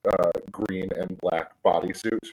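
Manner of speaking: ordinary talk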